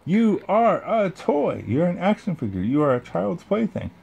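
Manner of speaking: exasperated outburst. Acted. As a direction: spell it out in disbelief, very high. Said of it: "Woody went ballistic".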